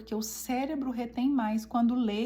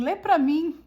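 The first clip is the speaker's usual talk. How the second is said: put-on raised, louder child's plea; imitating sleepy son's request; 5 semitones higher, 7 dB louder, slower than usual. Tired son asking mother to read, imitated pleading request. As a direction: lightly mimic a child asking, higher and louder. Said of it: "Ele virou e falou assim".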